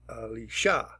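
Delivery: Alicia with the final "SHA" stressed; third-syllable stress. Shown as a demonstration. Teacher demonstrating rule three, Alicia stressed on its third syllable. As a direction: punch the last syllable of Alicia. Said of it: "you'll hear how she pronounces Alicia".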